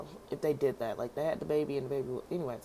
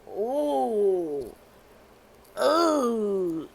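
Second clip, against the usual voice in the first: louder, crying ooh of pity; pained ooh; 4 semitones higher, 5 dB louder than usual. Real, not acted. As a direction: moan in mock grief. Reacting to the death.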